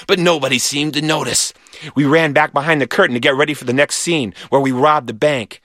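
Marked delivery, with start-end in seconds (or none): none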